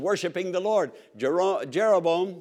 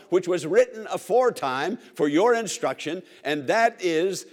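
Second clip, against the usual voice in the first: ordinary talk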